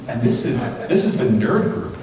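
ordinary talk, nothing marked

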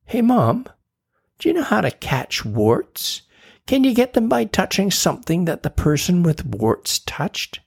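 put-on high, curious little girl; a kid's high questioning voice; child voice. Tina asking Mom about warts, high and curious.

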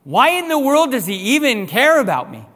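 loud, high, full-force pleading questions; impassioned preaching cry. Real, not acted. Preacher crying out his wonder to the congregation, loud and high.

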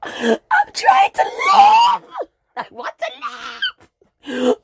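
put-on high, slow, crying kid begging; mock pleading kid. Little girl begging her mother not to make her go, crying wail. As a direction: wail it like a desperate child. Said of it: "I was just like".

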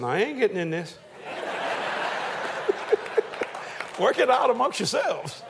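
the Lord laughing off a couple's quarrel; God's voice. Retold or imitated. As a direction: play God refusing to take sides, amused. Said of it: "The Lord said".

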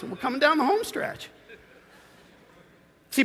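ordinary talk, nothing marked

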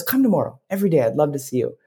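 high, coaxing storybook voice; wood carver. Acted. Wood carver invites Punchinello to visit daily, high coaxing voice. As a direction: invite him gently in a high voice. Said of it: "He goes".

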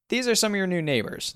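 high, smiley camp counselor introduction; smiling voice, lifted high. A narrator's reading. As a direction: present them with a big smile, pitched well up. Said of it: "the warden smiles".

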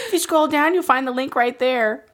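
high, put-on influencer plug; high-pitched influencer parody; mock influencer voice. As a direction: pitch it up like an influencer directing followers. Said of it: "impersonates their voice".

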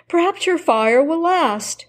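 a hint of false sweetness; wicked stepmother. Stepmother to Vasilisa.

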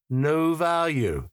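high, drawn-out chirp. A narrator's reading. Seller disclaiming the replicas' worth, chirping. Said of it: "I chirped".

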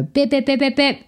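high-pitched imitated machine beeps; reboot beeps. Coach mimes rebooting out of autopilot, high robotic beeps. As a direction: beep like a machine restarting, high and even. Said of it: "What can I do to go".

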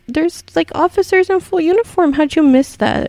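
how could you miss that. Real, not acted. Incredulous at the criminal.

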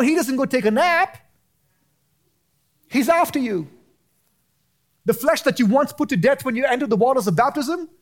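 high, urgent preacher's warning; impassioned preaching. Real, not acted. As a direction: preach it insistently, pitched well up. Preaches at full force.

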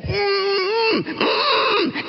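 high, loud muffled hums through closed lips; a mute man straining to tell the crowd. Acted. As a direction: try to shout with your mouth sealed. Mute priest trying to speak.

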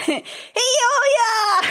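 very high, drawn-out squeal; playful high-pitched cry. Shown as a demonstration. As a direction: sing it out way up high, half laughing. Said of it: "you say it in a high-pitched voice".

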